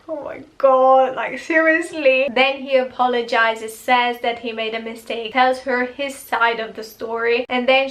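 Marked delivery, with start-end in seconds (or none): 0.1-2.3 exasperated disbelief. Real, not acted. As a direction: throw up your hands at the screen. Her exasperated reaction to the character.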